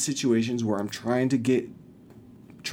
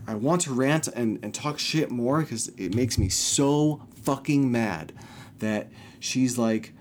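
ordinary delivery

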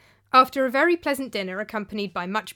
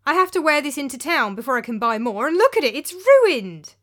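much higher and louder, indignant; angry complaint; 5 semitones higher, 8 dB louder than usual. Acted. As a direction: rage over the sandal. Philip angry over shoe.

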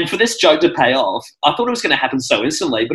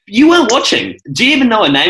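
exasperated retort; 8 semitones higher, 4 dB louder than usual. Retold or imitated. Family scolds dad during show.

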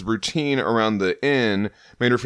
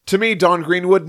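booming summons, raised pitch; loud heroic command; 8 semitones higher, 5 dB louder than usual. Acted. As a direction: call her out like a herald. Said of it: "I really like this cover".

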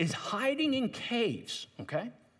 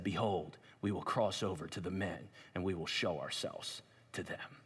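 hushed reading; 10 semitones lower, 8 dB quieter than usual. Acted. Reading Jonathan's words.

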